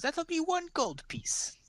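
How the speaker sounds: high and slow, a crone naming her price; old pie seller. Acted. Old woman asks the gnome for payment, high slow voice. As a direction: savour the price like a sweet old lady.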